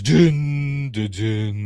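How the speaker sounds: sung-out suspense sting, first note punched; mock-dramatic "dun, dun, dun". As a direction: imitate a movie reveal sting, falling away. Mimics dramatic music sting at reveal.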